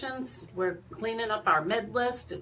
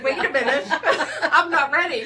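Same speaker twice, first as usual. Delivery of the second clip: laughing; 5 semitones higher, 8 dB louder than usual. Retold or imitated. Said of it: "I said".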